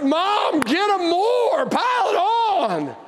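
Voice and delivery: high, drawn-out egging on; gleeful cheering. Retold or imitated. Child cheering a sibling's spanking to Mom, gleeful cheering. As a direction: cheer it on like a kid, stretching the words. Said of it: "we cheered when our siblings".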